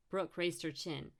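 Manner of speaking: ordinary delivery, nothing marked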